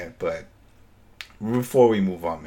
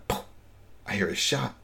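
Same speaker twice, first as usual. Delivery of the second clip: ordinary talk, nothing marked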